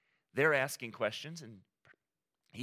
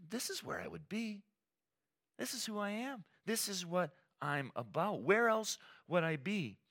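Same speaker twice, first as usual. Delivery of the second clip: lightly voiced boy, higher and earnest; a touch of a twelve-year-old's conviction; boy Jesus; 9 semitones higher than usual. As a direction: insist as a sure young boy. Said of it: "didn't you know I was gonna be".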